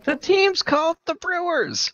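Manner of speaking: very high, drawn-out protest; mock exasperation. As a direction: stretch out the obvious in a high voice. Mock protest at the team's ban.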